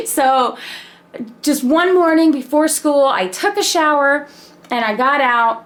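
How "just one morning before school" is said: ordinary delivery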